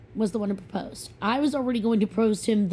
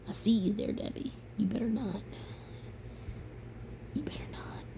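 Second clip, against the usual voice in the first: hushed playful warning; mock threat; 11 dB quieter than usual. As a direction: whisper a teasing threat at Debbie. Mock-threatening viewer Debbie.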